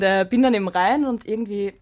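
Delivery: ordinary talk, nothing marked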